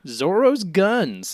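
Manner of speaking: slow, high admiring hype; hyped up. Acted. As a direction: savor it, impressed. Hyping Zoro's arms.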